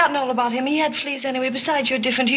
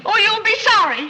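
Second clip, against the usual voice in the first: put-on high, pouting, childish warning; petulant threat; 10 semitones higher, 5 dB louder than usual. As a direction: sulk it out like a spoiled girl. Irene sulks, threatening the butler.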